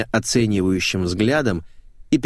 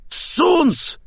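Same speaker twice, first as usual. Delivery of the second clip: very high cry; indignant correction. Acted. Flamboyant man indignantly corrects the name.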